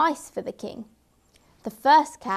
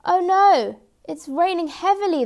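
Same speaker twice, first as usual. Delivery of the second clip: high, louder cry of alarm; dismayed; 8 semitones higher, 6 dB louder than usual. A narrator's reading. Cat caught in a downpour while hunting, dismayed. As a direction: wail it in storybook dismay.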